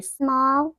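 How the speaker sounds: SMALL drawn out slowly for emphasis; stress on SMALL. Real, not acted. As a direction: stretch out the word SMALL. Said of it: "Note the emphasis on small".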